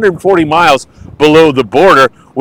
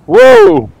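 startled high whoa; surprised whoa; 4 dB louder than usual. Real, not acted. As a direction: caught off guard by a wave. Tide rushing in.

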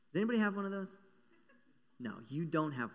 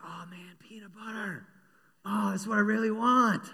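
breathy whisper then a louder, higher craving cry; a tempted little mouse drooling over bait; greedy mouse; 6 semitones higher, 7 dB louder than usual. Acted. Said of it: "That mouse is like".

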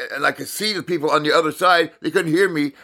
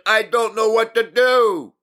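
high, slow, desperate outburst; exasperated cry; 9 semitones higher than usual. Retold or imitated. Retelling how he snapped on the call, high slow exasperated cry. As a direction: throw your hands up and plead it out. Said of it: "I snapped".

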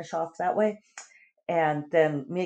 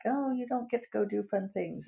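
higher, softer teasing imitation; mock pity; 6 semitones higher, 6 dB quieter than usual. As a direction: imitate someone gently teasing with false sympathy. David's mock pity, retold.

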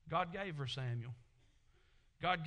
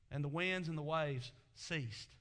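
usual speaking voice

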